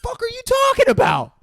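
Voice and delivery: very high, loud mock yell; incredulous outburst. Sid answering praise of the acting, incredulous yell. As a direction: yell it in total disbelief. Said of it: "And Sid is like".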